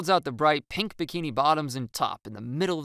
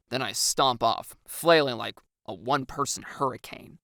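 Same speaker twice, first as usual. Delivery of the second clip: plain reading voice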